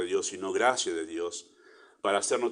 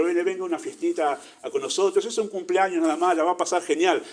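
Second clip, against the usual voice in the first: higher, louder, coaxing invite to a party; mock party invitation; 8 semitones higher, 5 dB louder than usual. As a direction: lure them in with a cheery fake invite. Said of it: "no vinimos diciendo". (invitación fingida y alegre, más aguda y fuerte)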